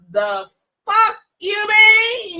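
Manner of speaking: high, long-drawn confrontational retort. Retold or imitated. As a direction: draw it out high, acting out the challenge.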